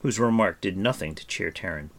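ordinary delivery

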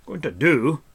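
loud, high incredulous echo; indignant surprise. A narrator's reading. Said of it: "looked at him with surprise".

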